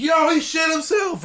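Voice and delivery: high excited yell, calling it out; mock shout. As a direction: shout it to the whole class like a kid. Classmates shouting in class.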